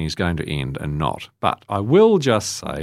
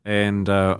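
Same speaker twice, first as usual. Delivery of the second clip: normal talking voice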